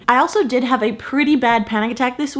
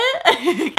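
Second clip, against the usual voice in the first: a high, breathy giggle acted out; retold laugh; 5 semitones higher than usual. Retelling her reaction to the voodoo doll, high nervous giggle. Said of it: "I just start laughing".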